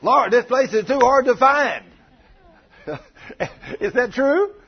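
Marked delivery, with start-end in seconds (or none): 0.0-1.9 loud, high-pitched complaint to the Lord; hammed-up whine of a grumbler; mock grumbling. Preacher imitates churchgoers trying to find this place, mock grumbling. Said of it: "Some of us grumbled and complained".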